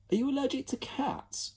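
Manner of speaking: put-on high boyish voice; Ned asking earnestly; boy's question. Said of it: "asked Ned".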